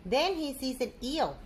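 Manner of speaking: usual speaking voice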